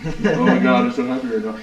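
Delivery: giddy retold joy. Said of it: "He was like".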